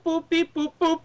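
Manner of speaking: high, loud robot beeping; R2-D2 beeps. Retold or imitated. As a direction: squeak out droid boops. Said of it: "rolling around on a scooter going boop".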